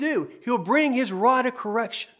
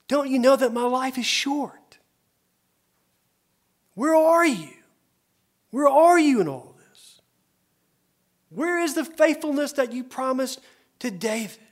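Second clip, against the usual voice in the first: longing cry; 5 semitones higher than usual. Acted. Preacher voicing the psalmist's anguished cry to God, longing. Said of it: "That's what the psalmist cries out".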